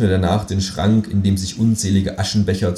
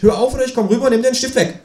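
much higher, fast mock threat; quick threatening kangaroo; faster than usual. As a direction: rattle off the threat like a cheeky bully.

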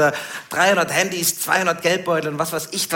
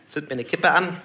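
ordinary delivery

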